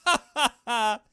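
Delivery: unhinged villain laughter; maniacal laugh. Acted. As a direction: burst into a crazed evil laugh.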